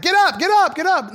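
high, loud urgent shout; retold yelling. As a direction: bark it at the fallen kid. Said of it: "I was like".

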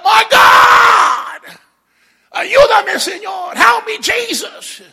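screaming high for help, Spanish and English; terrified cry out. Acted. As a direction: shriek it like sinking in a storm. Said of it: "they begin to cry out".

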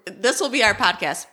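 ordinary delivery

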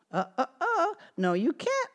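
gingerbread man voice. Acted. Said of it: "said the gingerbread man".

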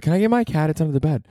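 high, innocent mock plea; playing her sweet and harmless; lightly voiced woman asking about her cat; imitating the lady. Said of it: "she's like".

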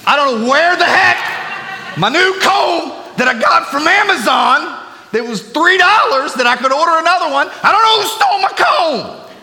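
high, loud comic complaining rant. Pretend. Preacher rants to church about his missing comb, high and loud. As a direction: whine about the stolen comb at full volume.

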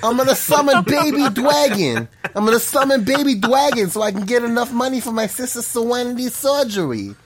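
high-pitched cartoon voice with R as W; hammed-up high cartoon impression; Joey Wheeler. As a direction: plead like a goofy anime sidekick. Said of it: "it's me, Joey Wheeler".

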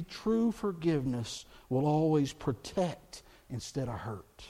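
ordinary talk

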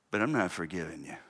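low, muted dismissal; soft, deadpan no; quiet stubborn refusal. Acted. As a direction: refuse under your breath, immovable. Acts out an unforgiving believer.

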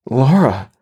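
put-on lightly boyish startled cry; a hint of a startled boy. A narrator's reading. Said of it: "sounding and looking like a boy again".

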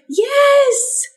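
very high, long praise word; upbeat encouragement. Acted. Owner to puppy army crawling, upbeat encouragement. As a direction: squeal approval to coax a pup.

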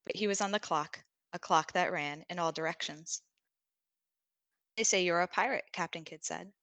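steady storytelling read; story narration. A narrator's reading. Reads a story aloud.

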